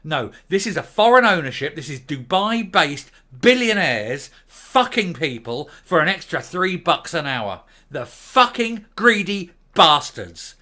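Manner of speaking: real fury, shouting the curses out; losing it. Real, not acted. Raging at Dubai billionaires, shouting furiously. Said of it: "I did really kind of lose my shit in that one".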